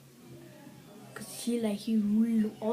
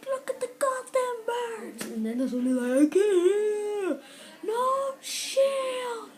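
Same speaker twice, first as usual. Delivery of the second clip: squeaky movie voice; 11 semitones higher, 5 dB louder, slower than usual. Retold or imitated. Acting out a movie character.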